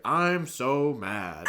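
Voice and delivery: very loud, drawn-out mock line reading; overdone announcing. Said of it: "can you say it more angry".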